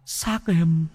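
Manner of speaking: high, eerie ghost voice of a murdered brother; ghost pleading. Acted. Dead brother's ghost warns Bá in a dream, eerie high plea. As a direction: wail it out like a drowned ghost begging.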